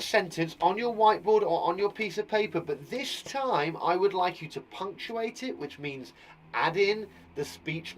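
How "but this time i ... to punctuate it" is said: normal talking voice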